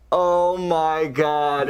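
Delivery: drawn-out, slow exclamation; mock dismay. Reporter quoting a courtroom onlooker, drawn-out dismay. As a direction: stretch each word out in slow disbelief. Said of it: "George Conway goes".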